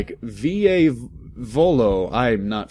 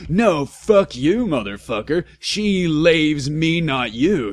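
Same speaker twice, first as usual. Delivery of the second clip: mock shout; 5 semitones higher, 3 dB louder than usual. A narrator's reading. Vampire shouting back at Draco, mock shout. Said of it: "shouted Vampire".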